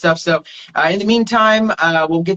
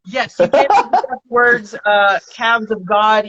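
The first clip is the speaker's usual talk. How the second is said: laughing; 3 semitones higher, much slower than usual. Real, not acted. Laughing while plugging band.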